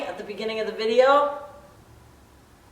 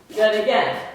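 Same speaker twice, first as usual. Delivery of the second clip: ordinary talk, nothing marked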